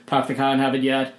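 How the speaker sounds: mock Boston accent with dropped R's; broad Boston vowels, no R's. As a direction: do a hammy Boston warm-up phrase. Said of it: "a line in a Boston accent".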